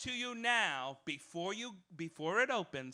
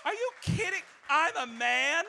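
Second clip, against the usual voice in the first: high, loud disbelief; much higher mocking disbelief; incredulous; 8 semitones higher, 6 dB louder than usual. Real, not acted. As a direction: scoff at the quote in disbelief.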